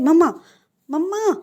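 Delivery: loud, high call for mom. Acted. Wing calling his mother downstairs, high loud shout. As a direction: yell mamma down the stairs. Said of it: "क्यों चिल्ला रहे हो?".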